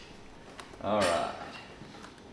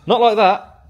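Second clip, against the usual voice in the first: much higher and louder, playful; high loud protest; 11 semitones higher, 13 dB louder, slower than usual. Real, not acted. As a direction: cry it out in amused protest. Laughing protest at coworker.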